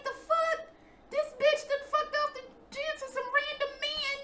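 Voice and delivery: high-pitched scandalized voice; judgy wives. Retold or imitated. Mimicking the coworkers' wives scandalized by her dancing, high.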